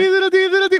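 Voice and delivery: mock telegraph ticking; telegraph imitation. Shown as a demonstration. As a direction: tap out a telegraph with your voice. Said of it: "Was that a telegraph machine?".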